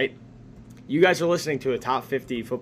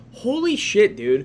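high, drawn-out amazed exclamation; retold amazement; 6 semitones higher, 3 dB louder, slower than usual. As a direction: blurt it in impressed disbelief, retelling your reaction. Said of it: "I'm like".

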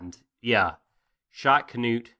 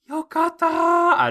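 much higher, louder joyful squeal; excited squeal; 9 dB louder, slower than usual. Retold or imitated. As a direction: squeal it out in a giddy falsetto. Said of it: "Thorfinn is just like".

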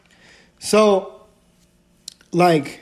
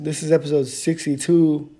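usual speaking voice

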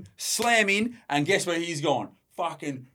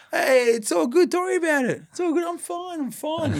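very high, dopey, cheerful voice; dazed cyclist; 13 semitones higher than usual. Retold or imitated. Concussed cyclist brushing off the crash to the driver, very high and dopey. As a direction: play it dazed and oblivious, pitched way up. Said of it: "delusional as fuck".

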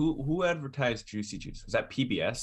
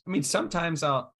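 voice cracking; 6 semitones higher than usual. Real, not acted. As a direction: voice cracks mid-sentence. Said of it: "my voice just went into a weird place".

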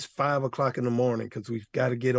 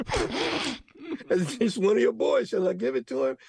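prayer to heaven; 9 semitones higher than usual. Retold or imitated. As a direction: ask heaven for permission, grinning. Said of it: "I looked up at the heavens and I said".

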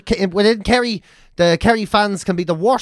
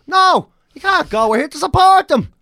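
mock fan reply; 10 semitones higher, 4 dB louder than usual. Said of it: "And you go".

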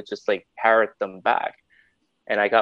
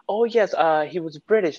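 high-pitched customer voice; phone caller; 7 semitones higher than usual. Retold or imitated. Customer recalling the agent's accent, high-pitched caller. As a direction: answer in a higher caller's voice.

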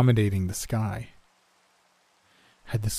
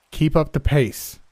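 loud, higher, stern call of his name; grim; 6 semitones higher, 5 dB louder than usual. Acted. Leader calls to Danny with a grim, loud command. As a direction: grimly order him to keep going. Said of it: "I said grimly".

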